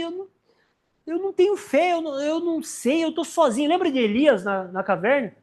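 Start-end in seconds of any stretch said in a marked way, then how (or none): 0.0-3.7 higher, mock forlorn whine; despairing believer. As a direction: whimper like someone who gave up. Men of God despairing. (homem de Deus lamentando, em tom choroso)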